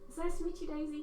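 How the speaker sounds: put-on British accent. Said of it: "I'm going to do British".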